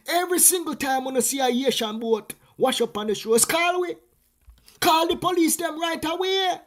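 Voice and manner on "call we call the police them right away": mock urgent, high and loud; urgent call to action. Community urged to call police, raised urgent voice. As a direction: shout the order like a neighbourhood warning. Community told to report boats.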